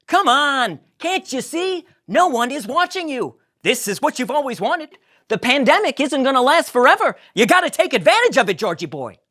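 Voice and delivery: high, loud upbeat Jerry; Jerry cheering up George; encouraging Jerry. Acted. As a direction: cheer your friend up.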